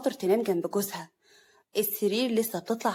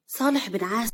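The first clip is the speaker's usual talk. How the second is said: ordinary delivery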